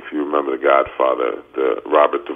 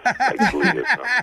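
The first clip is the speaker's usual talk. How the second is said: laughing. Real, not acted. Mocking a musical show.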